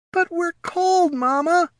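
high, slow pup whine; whining pup. A narrator's reading. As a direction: whine like a cold little pup. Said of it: "whined a young gnoll".

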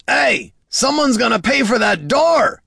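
loud, high angry shout. A narrator's reading. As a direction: yell it after someone leaving. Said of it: "someone shouted from behind them".

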